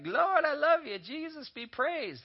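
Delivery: high, slow, gushing mock praise; sing-song churchy praise voice, hammed up; mock worshipper. Preacher mimicking shallow Sunday worshippers, gushing. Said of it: "be like".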